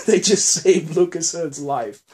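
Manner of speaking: words laughed out; giddy with relief; laughing relief. Real, not acted. Recapping the rescue to viewers, laughing.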